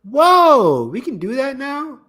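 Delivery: loud, high burst of surprise; amazed whoa. Real, not acted. Co-host reacts to the uploaded trailer trick, amazed. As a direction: exclaim high in astonishment.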